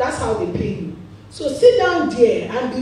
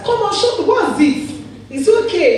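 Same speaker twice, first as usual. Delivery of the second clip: irritated mother; 7 semitones higher, 4 dB louder than usual. Retold or imitated. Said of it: "she became irritated".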